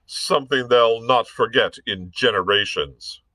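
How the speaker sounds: bullying officer voice, loud and scornful; blustering captain. Acted. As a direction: bark it like a brutish dragoon. Hobart's blustering threats.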